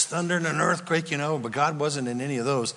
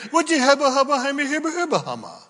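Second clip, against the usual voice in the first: high, hammed-up gibberish complaint; mock babble; 10 semitones higher, faster than usual. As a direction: babble like an excuse-maker.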